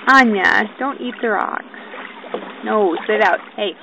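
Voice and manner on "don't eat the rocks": ordinary talk, nothing marked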